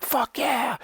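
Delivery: high-pitched hyped-up cheer; mock caffeine-high yelp; pumped-up. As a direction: squeal it out, amped on energy drinks. Energy drink hype acted out.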